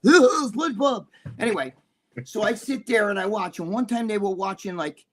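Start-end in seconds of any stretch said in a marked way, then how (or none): 0.0-1.1 cartoon voice. Imitating SpongeBob cartoon voices.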